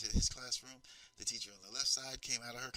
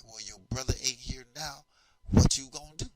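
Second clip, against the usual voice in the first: a mocking teacher daring the kid, shouting by the end; taunting threat; 3 semitones higher, 10 dB louder than usual. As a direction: get in his face and dare him. Teacher confronts boy face to face.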